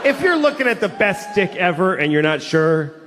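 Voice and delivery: ordinary delivery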